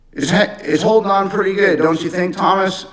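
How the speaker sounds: a hint of a gentle elderly man asking fondly; old man. Voicing the 94-year-old man.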